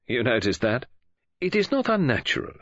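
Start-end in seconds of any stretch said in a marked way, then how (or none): 1.4-2.5 primly. A narrator's reading. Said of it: "said Poirot primly".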